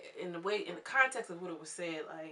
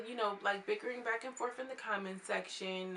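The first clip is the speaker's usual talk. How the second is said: normal talking voice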